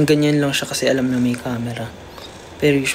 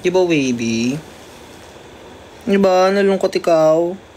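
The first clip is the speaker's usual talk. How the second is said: pet talk; 5 semitones higher, slower than usual. Real, not acted. Talking to her cat.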